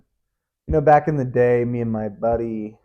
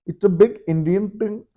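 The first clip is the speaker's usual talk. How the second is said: put-on a quick jokey Indian accent, pitched higher; 8 semitones higher than usual. Trying out his Indian accent on viewers, mock Indian accent. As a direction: toss off the line in a light Indian accent. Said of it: "You can do Indian accent".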